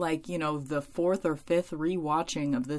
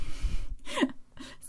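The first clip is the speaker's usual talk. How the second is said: breathy laugh. Real, not acted. Laughs at own sorting.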